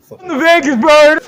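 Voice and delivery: very high, very loud shriek; sudden yell. Real, not acted. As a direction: blurt it out at the top of your lungs. Outburst ending the rant.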